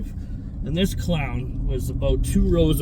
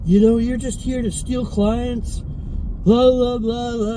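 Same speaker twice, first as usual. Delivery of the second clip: higher, slower mocking rant of a cranky old man; imitating the old man screaming at him, mocking; mock old man yelling; 9 semitones higher, 3 dB louder, slower than usual. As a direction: parody his ranting, dismissive blah blah. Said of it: "he starts fucking yelling at me".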